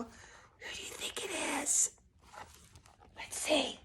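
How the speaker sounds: hushed, breathy question; whisper. Acted. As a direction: whisper it with suspense. Whispered aside to the children.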